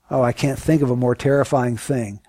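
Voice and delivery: ordinary talk, nothing marked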